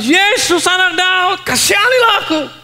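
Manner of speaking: high, loud desperate shout for mercy. Acted. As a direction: yell it over the crowd, even harder. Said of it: "teriak lebih keras".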